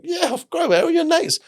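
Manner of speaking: very high, beaming friendly voice; friendly Ramon. Retold or imitated. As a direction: squeak out a delighted of course.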